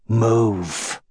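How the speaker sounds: low, menacing one-word order; growled command. A narrator's reading. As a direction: growl it without raising the volume. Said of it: "he growled".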